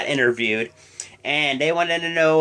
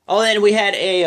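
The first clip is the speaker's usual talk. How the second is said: ordinary talk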